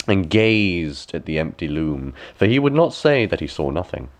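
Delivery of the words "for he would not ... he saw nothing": usual speaking voice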